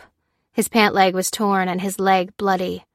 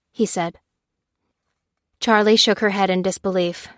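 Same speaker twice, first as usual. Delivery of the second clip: usual speaking voice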